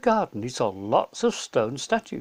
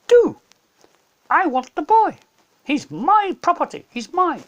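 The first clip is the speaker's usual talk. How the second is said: much higher, much louder possessive cry; screeching witch; 14 semitones higher, 8 dB louder than usual. Acted. Witch insists to Aslan, screeching. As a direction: shriek that the boy belongs to her. Witch repeats her demand.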